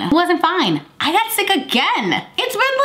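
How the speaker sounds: high-pitched mock whine of disbelief; comic exasperation. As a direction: complain in a hammed-up high pitch. Comic exasperation at repeated sickness.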